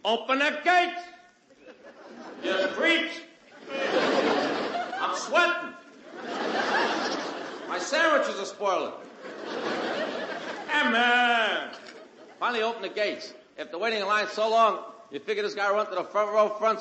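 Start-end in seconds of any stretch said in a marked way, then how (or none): 0.1-11.7 pitched-up comic yell at the gatemen; whiny hammed-up complaint; impatient fan calling out at the gate; mock yell. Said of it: "he yells to somebody".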